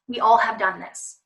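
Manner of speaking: normal talking voice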